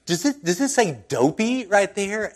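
lightly mocking retort; sarcastic jab. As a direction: needle him with it. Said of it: "it's just like".

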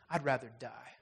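low, soft, different ashamed voice; hushed despair. Retold or imitated. Ghost refuses the spirit, low and soft. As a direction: breathe it out in despair. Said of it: "ghost refuses".

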